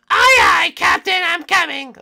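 very high, shouted falsetto; eager crewman. Pretend. Crewman answering the captain, eager falsetto shout. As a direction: holler back to the captain in a squeaky put-on voice.

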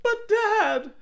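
high, slowed plea to dad; pleading daughter. Acted. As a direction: beg him to understand. Daughter pleading with her father.

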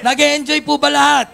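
calling out to crowd. Real, not acted. Host calling out to audience.

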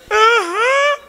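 put-on little boy. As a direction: whimper yes like a small boy. (aham de criança, bem agudo)

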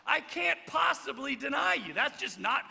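mock indignant shout; mock Peter shouting. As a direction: overplay a proud loyalist. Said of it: "he might have said".

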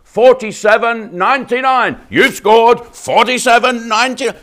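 high, loud game-show announcer shout; loud, high prize reveal; game show hype. Acted. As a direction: sell the price like a jackpot win. Hyping the subscription price.

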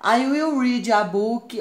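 slow, emphatic example sentence; slow model sentence. Shown as a demonstration. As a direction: spell the sentence out for beginners. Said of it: "aqui está na forma afirmativa".

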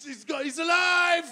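put-on high, shouting excited kid; a toddler yelling as a parent comes and goes; frantic toddler. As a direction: swing wildly between joy and panic.

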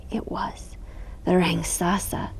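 hushed spooky whisper; breathless suspense whisper. A narrator's reading. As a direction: whisper it like a secret. Storyteller builds suspense.

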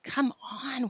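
urgent hushed whisper; soft voiceless coaxing. A narrator's reading. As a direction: whisper it to your friend. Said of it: "whispered Tom".

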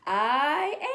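loud cheering shout of "I am". Shown as a demonstration. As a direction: shout it out big.

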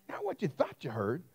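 usual speaking voice

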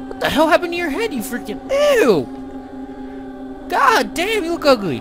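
high, fast grossed-out squeal; disgusted shriek. Real, not acted. Mocking an ugly character.

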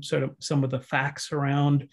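normal talking voice

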